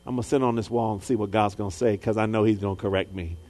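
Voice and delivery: low, soft, quick resigned mutter; quietly resigned prophet. Retold or imitated. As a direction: settle down on the wall, grumbling. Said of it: "he said".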